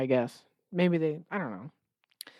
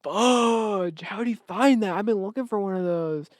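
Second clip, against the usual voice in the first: jealous contestant; 4 semitones higher than usual. Retold or imitated. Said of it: "another guy going".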